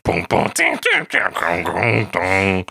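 booms and dings jumping in pitch; industrial synth imitation. Co-host illustrating the crunchier synth, imitated clangs and booms. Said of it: "almost industrial synth".

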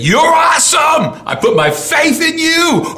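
high, loud shout of praise; shouting to the Lord. Retold or imitated. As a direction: re-enact crying out to God at full voice. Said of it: "I begin to shout to the Lord".

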